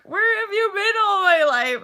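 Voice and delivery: put-on high, loud, tearful plea of a child; tearful inner child. Voicing her inner child, tearful and pleading. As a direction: let the little girl inside cry out. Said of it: "my little inner child is like".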